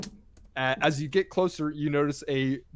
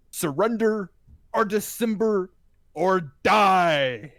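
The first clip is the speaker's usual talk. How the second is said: huge booming voice bellowing out; booming shout; 6 semitones higher, 8 dB louder, much slower than usual. A narrator's reading. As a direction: boom it across the town. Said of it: "you hear a booming voice".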